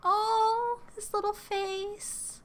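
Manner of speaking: high-pitched, drawn-out pitying wail; playfully distraught, high cooing; mock crying. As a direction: whimper over something adorable and doomed. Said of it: "Oh, I'm crying".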